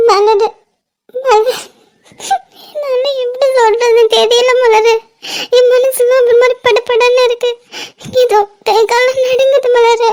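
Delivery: halting, tearful and overwhelmed; crying, panicky. Acted. Too shaken to tell her secret, crying. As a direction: struggle to get words out through sobs. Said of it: "இப்படி அழாதடி".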